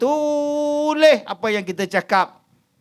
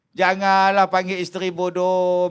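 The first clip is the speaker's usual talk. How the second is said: usual speaking voice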